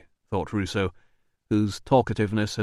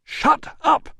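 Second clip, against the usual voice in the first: loud, very high yell; 5 dB louder than usual. Acted. Manager yells at his doorman to shut up, loud and high. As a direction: bark him silent.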